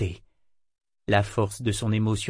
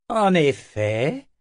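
slow, smug and higher; 6 semitones higher, 4 dB louder, much slower than usual. A narrator's reading. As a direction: savour the words smugly. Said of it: "sur un ton suffisant".

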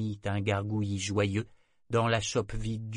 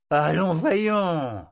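sifflé, lent et haut; voix sifflante; 8 semitones higher, 7 dB louder, much slower than usual. A narrator's reading. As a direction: souffle les mots entre les dents. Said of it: "d'une voix sifflante". (matelot ivre qui pousse Brachen, d'une voix sifflante)

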